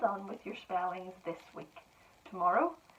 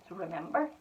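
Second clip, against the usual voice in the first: ordinary talk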